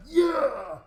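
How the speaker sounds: high, loud squeaky cheer; a tiny creature whooping in triumph; muffled triumphant cheer. Acted. GM voicing the creature inside the Behir after a hit, loud high cheer. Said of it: "you hear a".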